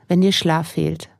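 normal talking voice

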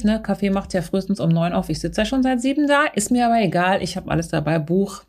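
usual speaking voice

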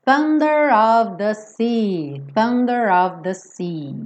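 slow Thunder with a clear th; careful Thunder of the sea. Shown as a demonstration. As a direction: slowly stress the th of Thunder. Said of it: "agora sim".

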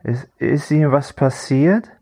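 high, slow, stammering concern; betrunkener Mann. A narrator's reading. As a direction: ask it haltingly, drunk and worried. Betrunkener Mann fragt besorgt nach Sturz. (betrunken und besorgt stammelnd)

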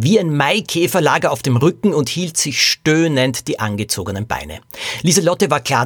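ordinary delivery, nothing marked

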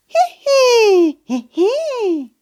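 squeaky high creature giggling; high giggle. Acted. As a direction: giggle with tiny delight. Ball giggles at the wish.

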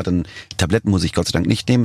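ordinary delivery

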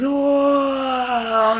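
high, delighted oh; excited cry. Acted. Guide voices the child's joyful cry on the carousel. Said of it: "und schreist".

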